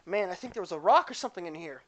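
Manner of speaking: high yelp then fast grumble; clumsy girl voice. Acted. Clumsy girl tripping on her own feet, high yelp. Character trips, exclaims.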